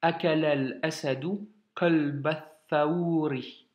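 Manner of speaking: "Akalal asadou kolba thaouri" read slowly with every liaison joined; teacher's final model reading. Shown as a demonstration. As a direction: recite the phrase slowly and clearly for a learner. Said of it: "je te la relis une dernière fois".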